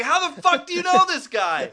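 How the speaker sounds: shouted high and loud, disbelieving; incredulous yell. Retold or imitated. Mocking the film's plot, an incredulous high shout. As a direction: blurt it out in outraged disbelief. Mock outburst at the film.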